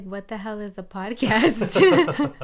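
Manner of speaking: loud, slow, laughing mock bafflement; comically exaggerated disbelief; retold bafflement. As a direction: play up the bewilderment, drawn out. Retells own bafflement at podcast.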